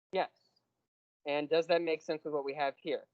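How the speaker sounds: ordinary talk, nothing marked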